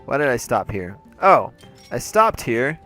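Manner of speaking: usual speaking voice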